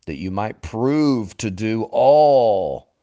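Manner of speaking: "all" stretched long and loud; stress on "all". Real, not acted. Preacher quoting Joshua's verse, hammering "all". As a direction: swell hard on "all" and hold it. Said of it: "I emphasize that word every time".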